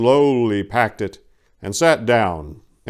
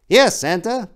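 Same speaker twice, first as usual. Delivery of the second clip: much higher, eager little elf; 12 semitones higher, 4 dB louder than usual. Acted. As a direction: play the small helper keen to please. Elf Jacob again.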